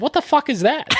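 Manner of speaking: high-pitched mock outrage; retold pissed-off outburst. Friend glaring at spilt popcorn, imitated pissed-off outburst. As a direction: imitate a friend's indignant demand. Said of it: "you were pissed".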